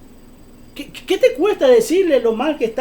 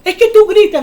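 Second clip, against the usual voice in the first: reclamo imitado, fuerte y agudo; reproche imitado; 8 semitones higher, 7 dB louder than usual. Retold or imitated. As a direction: imita a quien te reprocha alzando la voz. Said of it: "me dice". (reproche imitado en voz alta)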